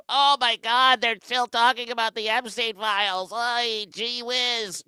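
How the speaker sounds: high, loud whiny impression; whiny mock voice. Mimicking a whining supporter, high and loud.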